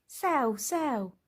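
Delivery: high, brisk boss barking orders; high-pitched businessman; busy businessman voice. A narrator's reading. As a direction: snap it like a busy executive. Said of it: "He said things like".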